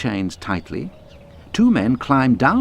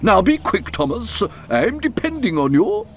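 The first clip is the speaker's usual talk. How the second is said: urgent controller; 12 semitones higher than usual. A narrator's reading. Fat controller urges.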